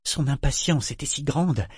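ordinary delivery

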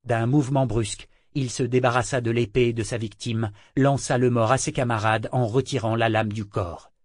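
ordinary delivery, nothing marked

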